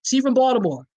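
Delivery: high, playful Baltimore accent. Shown as a demonstration. Host repeating her shout-out after laughing, playful Baltimore accent. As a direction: play up the Baltimore pronunciation. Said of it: "You gotta say it like that".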